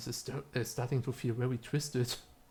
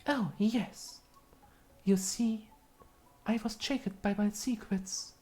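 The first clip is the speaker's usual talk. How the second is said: higher, eerie confessing voice; spirit voice; 9 semitones higher than usual. Acted. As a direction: confess your secrets like a ghost.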